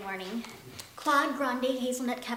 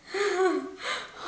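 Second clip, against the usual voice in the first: nervous laugh; 6 semitones higher than usual. Acted. Assistant laughs nervously at boss.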